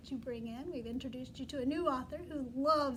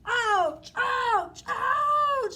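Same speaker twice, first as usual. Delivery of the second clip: loud yelps rising higher each time; howling in pain; 9 semitones higher, 12 dB louder, much slower than usual. Acted. Teacher as the bear, howling louder and higher. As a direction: howl like a bear being stung. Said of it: "howled the bear again and again".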